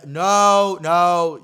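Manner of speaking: loud, high, drawn-out retold refusal; shouted refusal. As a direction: shout it as an emphatic mock protest. Acts out refusing his advances.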